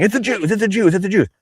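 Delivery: high, mocking singsong repeat; mocking quote. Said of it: "A lot of people saying".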